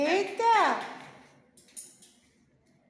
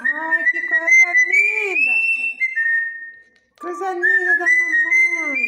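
loud, gushing pet praise; squeaky praising a dog; 8 dB louder than usual. Real, not acted. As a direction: gush over the pet loudly. Loudly praising her pet parrot.

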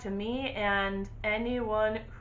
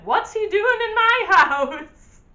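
laughing disbelief; 10 semitones higher, 9 dB louder, slower than usual. Retold or imitated. Friend alarmed by the ghost.

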